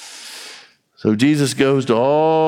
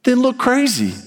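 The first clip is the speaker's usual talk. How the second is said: blunt retort; 14 semitones higher than usual. Retold or imitated. God answers the worried believer.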